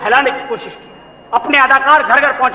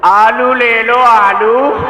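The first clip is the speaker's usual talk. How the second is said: sing-song hawker cry for potatoes; melodic vegetable vendor call; 4 dB louder, much slower than usual. Pretend. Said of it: "Mohammad Ali की आवाज में आलू बेचेगा".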